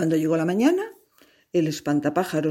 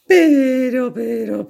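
high, loud, stretched "Pero"; "Pero" said high and loud, drawn out as a warning; a big, high, loud "Pero"; drawn-out but; 6 semitones higher, 7 dB louder, much slower than usual. A narrator's reading.

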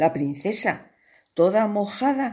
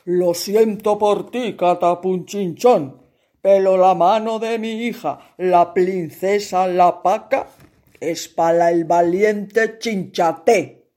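louder, solemn royal verdict; regal king; 5 dB louder than usual. Acted. King tells the cowardly prince, solemn. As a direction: pronounce it like a king. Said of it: "habló".

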